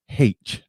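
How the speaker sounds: H imitated as "haitch"; slow, drawn-out "haitch" for H; British H. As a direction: put an h sound before H. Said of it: "that y'all say".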